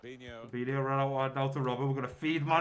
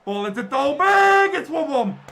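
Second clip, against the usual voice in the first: alarmed shout; 9 semitones higher, 10 dB louder than usual. Real, not acted. Opponent scores.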